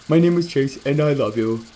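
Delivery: lightly voiced goofy dog persona; a quick silly dog voice; Chase voice. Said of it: "this voice every time I think of Chase".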